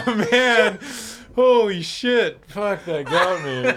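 can barely get words out for laughing. Real, not acted. Co-host still laughing at the host's look, words full of laughter.

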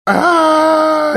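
high, loud bawling wail; wailing sob. A narrator's reading. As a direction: howl out like a sobbing child. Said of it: "ревел мистер Тумнус".

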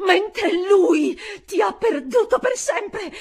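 high, loud defiant cry; triumphant shout. Acted. Governess shouting at the unclean being, triumphant cry. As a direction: hurl it at the demon. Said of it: "gridai rivolta all'essere immondo".

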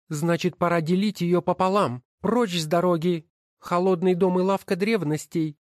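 high, scornful woman's voice; wife's voice. Acted. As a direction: sneer at each title as you sort them. Wife scolds her husband. (высокий насмешливый голос жены)

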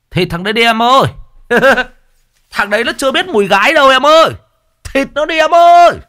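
high, loud jeering cheer; egging on. A narrator's reading. Rowdy villagers urging the ghost, loud high jeering. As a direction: whoop at the ghost to go for him. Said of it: "nó nói như khích lệ".

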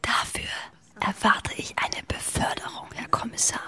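soft whisper in his ear. Acted. Undercover policewoman whispers to the inspector, breathy whisper. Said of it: "flüstern Sie mir was ins Ohr".

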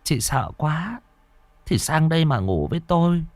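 resigned invitation with a sigh; weary grandmother, soft and high; sighing grandma. Acted. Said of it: "Bà nội chỉ thở dài vẫy vẫy".

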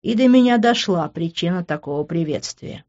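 usual speaking voice

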